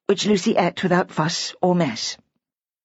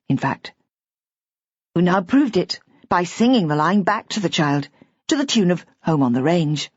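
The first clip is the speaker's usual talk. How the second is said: ordinary delivery, nothing marked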